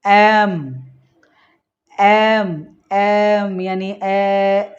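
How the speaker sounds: एम said on its own, then drawn out very slowly to reveal ए; sound demo. Shown as a demonstration. Teacher isolates the opening sound of एम for students. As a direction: isolate एम and stretch it to ए. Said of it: "आपको भी सुनना है sound".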